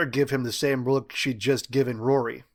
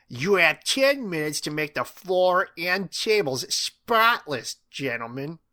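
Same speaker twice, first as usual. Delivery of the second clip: high, slow, commanding teacher; Missus King; 5 semitones higher, 3 dB louder, slower than usual. A narrator's reading. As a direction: lay down the deadline word by word. Teacher sets boys a deadline.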